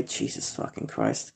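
under the breath, almost voiceless; muttered curse. Real, not acted. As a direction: mutter it to yourself in disgust. Muttered aside about Mother's Day.

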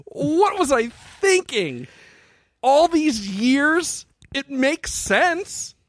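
high, slow, half-crying revelation; anguished epiphany. Retold or imitated. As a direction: overwrought wail of realization. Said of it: "and I went".

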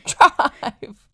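high, very loud yelled drive; imitated scream. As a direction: copy her panicked scream at the driver. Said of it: "she screamed".

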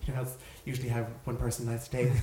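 usual speaking voice

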